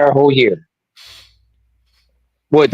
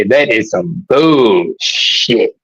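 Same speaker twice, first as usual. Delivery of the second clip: lower, very slow, lightly voiced old-timer; old school backpack voice; 6 semitones lower, much slower than usual. Cohost in old school character, mildly low and slow. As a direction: drag it out low like a fed-up elder. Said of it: "I say this with an old school JanSport backpack".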